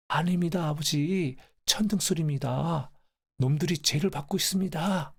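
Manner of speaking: high-pitched urgent pleading; father reassuring grandfather. Acted. Son tells his dying father it is only thunder, urgent reassurance. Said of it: "아버지가 하시는 말씀에".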